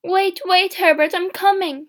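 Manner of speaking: high, loud calling out; urgent cry. A narrator's reading. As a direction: call frantically to someone outside. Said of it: "she cried".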